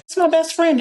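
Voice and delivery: mock-smug boast; playful brag. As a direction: drop it like a casual flex. Retells her own proud answer.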